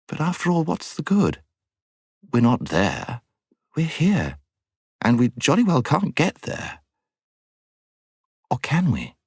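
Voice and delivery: high-pitched schoolgirl raising objections; schoolgirl Jill, doubtful. Acted.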